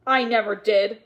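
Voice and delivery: loud, forceful angry denial; hard-hit indignant denial; snarling. A narrator's reading. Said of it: "snarled Mister Nye".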